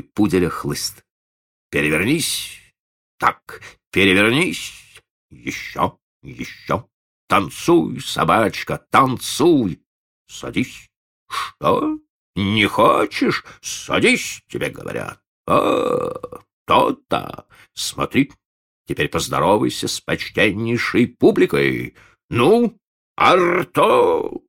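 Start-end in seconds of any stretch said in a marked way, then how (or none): 1.7-10.9 put-on old showman barking commands at a dog; booming circus ringmaster. A narrator's reading. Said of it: "грозно возвысил голос Ладышкин". 11.3-24.4 booming ringmaster, slow and threatening; menacing raised voice. A narrator's reading. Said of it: "грозно возвысил голос Ладышкин".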